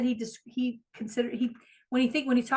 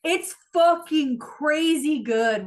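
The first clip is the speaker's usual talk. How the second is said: slow, drawn-out rave, higher and louder; gushing; 6 semitones higher, 8 dB louder, much slower than usual. Real, not acted. Friend raving about a podcast to her cohost, excited drawn-out gush. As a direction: stretch every word in delight.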